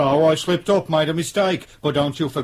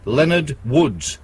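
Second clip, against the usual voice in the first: "Leonard Woods" spelled out very slowly word by word; slow deliberate repeat; much slower than usual. Acted. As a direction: drag out "Leonard Woods" so the radio catches it.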